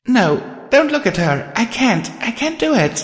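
high, distraught inner voice; desperate. A narrator's reading. Star forbidding self to look at her, desperate. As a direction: urge yourself away in rising panic. Desperate thoughts, avoiding the ghost.

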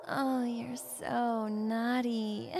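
slow, low playful tease; teasing. Acted. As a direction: purr it playfully. Teasing tickler.

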